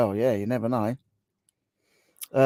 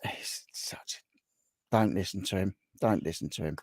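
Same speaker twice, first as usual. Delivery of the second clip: hushed, secretive aside to viewers; whispered aside; 5 semitones lower, 10 dB quieter than usual. Real, not acted. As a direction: lower the voice, don't let him hear.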